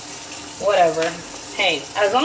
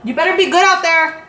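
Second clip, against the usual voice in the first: loud, high call from afar; calling a warning; 7 semitones higher, 7 dB louder than usual. Real, not acted. Mom calling to kids heading outside, warning. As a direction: holler a parental warning.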